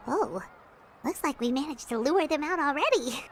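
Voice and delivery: hushed aside. Real, not acted. Aside while baiting scammers.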